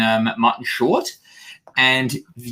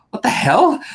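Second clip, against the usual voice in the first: shocked; 13 semitones higher, 4 dB louder than usual. Retold or imitated. Viewer's shocked reaction.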